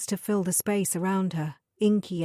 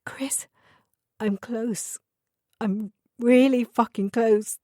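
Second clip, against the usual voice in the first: from a tearful whisper to a louder cry; 3 semitones higher, 3 dB louder, slower than usual. A narrator's reading. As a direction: whisper breaking into tearful outburst. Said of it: "she said through her tears".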